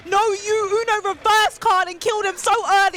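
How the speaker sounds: high, loud hype yell; excited shout. Real, not acted. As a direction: shout in disbelief. Hype reaction to the kill.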